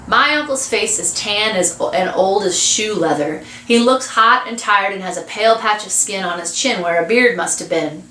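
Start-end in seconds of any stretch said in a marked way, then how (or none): none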